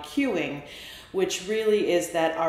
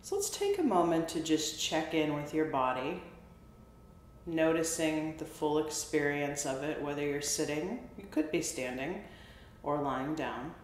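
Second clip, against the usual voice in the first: soft, unhurried meditation voice with long pauses; guided body scan; 6 dB quieter than usual. Real, not acted. Therapist guiding listeners through a body scan, soft meditation voice. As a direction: softly call out each body part, leaving space after it.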